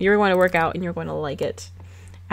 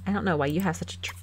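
soft, half-whispered prissy mimicry; mocking Hannah whisper; 9 dB quieter than usual. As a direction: breathe it out as a smug know-it-all. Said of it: "Hannah's like".

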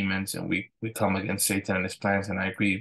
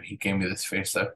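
usual speaking voice

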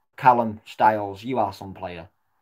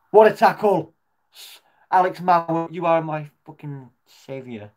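high, loud cheering relief; real elated shout; 6 semitones higher, 5 dB louder than usual. Real, not acted. Fan praising a last-ditch tackle, high elated shout. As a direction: cheer the defender like a hero.

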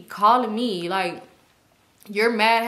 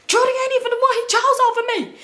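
very high, loud, rushed yell; high-pitched rapid-fire shouting; retold shouted outburst; 5 dB louder, much faster than usual. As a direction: yell it fast at the top of your range. Retold outburst, mad at him.

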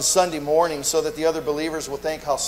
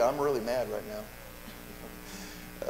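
softer, slow and low, admitting it under the breath; quiet confession; 5 semitones lower, 11 dB quieter, much slower than usual. Acted. Church member admitting anger to a greeting brother, quiet and slow. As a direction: let the anger slip out quietly and slowly. Acted honest reply to greeting.

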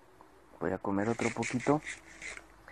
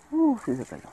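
surprised; 12 semitones higher, 6 dB louder than usual. Real, not acted. Mob falls off the ledge.

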